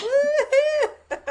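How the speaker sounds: high "woo-hoo" joyride whoop; carefree whoop. Retold or imitated. Cocky teen steering one-handed, high gleeful whoop. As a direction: whoop high, breaking into laughter.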